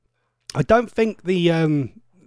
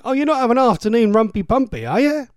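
teasing; 7 semitones higher than usual. Real, not acted. Teasing a listener.

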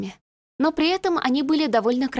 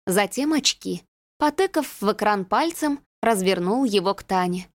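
ordinary talk, nothing marked

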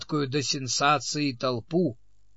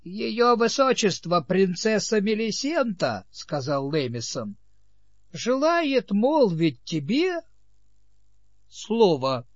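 pompous announcer; 6 semitones higher than usual. Acted. Herald-like Lamison announces princess.

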